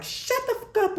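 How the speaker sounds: high mocking snap; imitating her cursing, pitched far up; mock rude retort. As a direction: toss it back in a high mocking voice. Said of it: "she said something like".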